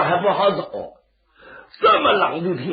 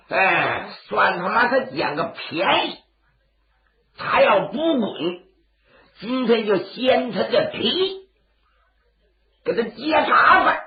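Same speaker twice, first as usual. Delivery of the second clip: menacing thug; 5 semitones higher than usual. Acted. Thug threatens Zhang.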